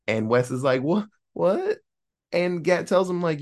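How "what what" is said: squeaky high incredulous; shocked what. Retold or imitated. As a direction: jump way up in disbelief. Wes shocked at liver demand.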